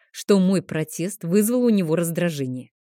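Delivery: ordinary delivery, nothing marked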